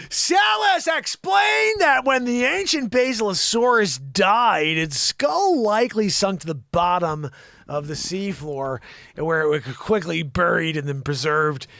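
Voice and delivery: loud, slow announcer-style reading; news read. Acted. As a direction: project the story slowly, starting high and settling. Resumes reading science story.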